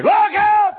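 loud, high yell of alarm; warning yell. Retold or imitated. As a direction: shout it to stop a child. Warning a child about car.

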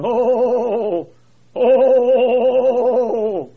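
drawn-out moaning groans; loud mournful groaning. Retold or imitated. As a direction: groan it out in anguish. Groaning preacher overheard by lake.

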